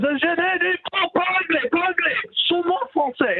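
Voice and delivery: mock stubborn French agent repeating himself; imitating an unbending francophone border guard. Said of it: "He goes".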